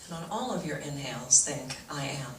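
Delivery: soft, low meditation voice with long pauses; guided meditation. Real, not acted. Teacher leads audience through breathing meditation, soft guided-meditation voice. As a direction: guide them gently into calm, hushed.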